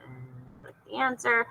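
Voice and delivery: soft whispered aside; whispering. Real, not acted. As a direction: breathe it without voice. Whispered aside during quiz.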